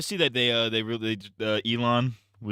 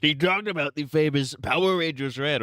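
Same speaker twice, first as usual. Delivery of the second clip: raised, put-on presidential voice; Trump impression; 4 semitones higher than usual. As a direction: do a mock Trump. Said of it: "doing a Trump impression".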